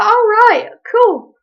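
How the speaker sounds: high-pitched, airy mock "oh, right"; put-on high, noncommittal reply; mock casual acceptance. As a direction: act politely going along with it. Acting her reply to sister.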